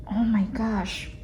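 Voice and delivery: ordinary delivery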